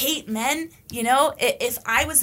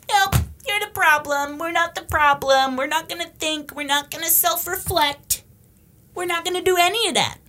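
high, mock-defiant chant; pitched-up, dismissive parody of stubbornness; mocking stubborn refusal; 7 semitones higher, 3 dB louder than usual. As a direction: imitate someone obstinately refusing to listen. Mocking people who refuse to self-reflect.

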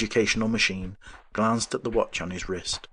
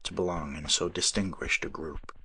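soft whisper; 4 semitones lower, 7 dB quieter than usual. A narrator's reading. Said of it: "he whispered to that distant man".

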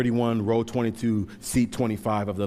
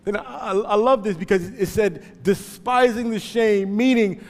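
loud, high, driving sermon voice; full-force preaching; 13 semitones higher, 9 dB louder than usual. Acted. Preacher urging the congregation to run to the cross, loud and high. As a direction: preach it to the rafters.

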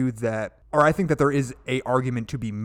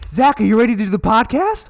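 high, loud puppy-talk voice; 11 semitones higher, 10 dB louder than usual. Acted. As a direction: gush at him like a good dog. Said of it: "Talking to Zach Like a Puppy".

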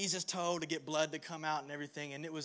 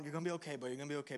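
put-on soothing baby; 3 semitones lower, 6 dB quieter, much faster than usual. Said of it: "looking at him and going".

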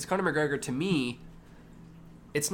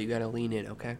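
quiet; 3 semitones lower, 7 dB quieter than usual. Acted. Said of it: "So I'm gonna talk quietly".

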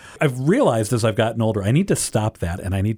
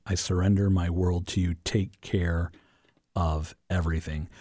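much softer, low prayer; hushed prayer; 4 semitones lower, 6 dB quieter than usual. Acted. Co-host echoes the week's prayer back, hushed and prayerful. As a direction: breathe the prayer out softly.

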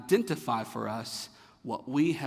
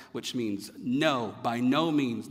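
ordinary talk, nothing marked

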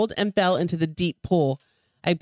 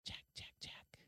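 unvoiced cold, triumphant whisper; triumphant hiss; 30 dB quieter than usual. A narrator's reading. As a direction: hiss it in quiet victory. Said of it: "a voice hissing in the cold triumph".

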